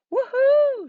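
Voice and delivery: very high, loud cheer; gleeful shout. A narrator's reading. Max cheers at seeing a temple with mummies, whooping high and loud. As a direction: whoop for joy. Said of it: "shouted Max".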